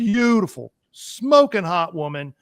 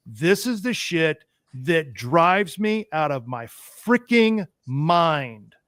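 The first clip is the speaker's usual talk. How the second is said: ordinary talk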